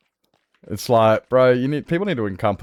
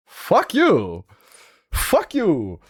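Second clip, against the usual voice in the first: indignant retort; 6 semitones higher, much slower than usual. Retold or imitated. Acts out retort to rude newcomer.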